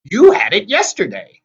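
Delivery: high, loud accusing voice; screeching hag. A narrator's reading. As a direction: screech the accusation at your sister. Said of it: "Tempest screeched".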